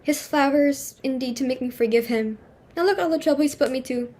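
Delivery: ordinary delivery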